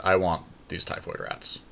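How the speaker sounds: normal talking voice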